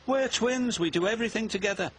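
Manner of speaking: high, fast protest; complaining. A narrator's reading. Bill insists twins share everything, high complaining. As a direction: plead the unfairness in a rush. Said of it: "Bill complained".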